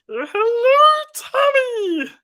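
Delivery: very high, loud squealed hello; high cartoon greeting. Streamer greeting Tommy in character, high loud squeal.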